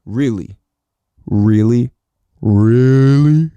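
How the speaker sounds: escalating incredulous Really; building disbelief. Real, not acted. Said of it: "repeated disbelief".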